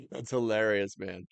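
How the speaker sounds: ordinary talk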